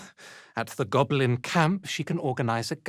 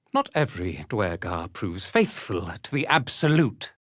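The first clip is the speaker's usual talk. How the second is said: ordinary talk, nothing marked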